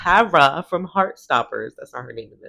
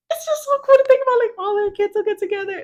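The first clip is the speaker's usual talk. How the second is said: much higher, fast and excited; excited gush; 4 dB louder, faster than usual. Real, not acted. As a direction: gush about it in delight. Gushing about the family house.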